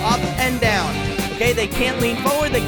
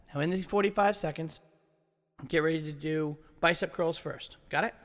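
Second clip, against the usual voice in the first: much softer, lower, relaxed; soft, calm instruction; 7 semitones lower, 11 dB quieter than usual. Real, not acted. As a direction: wind down gently.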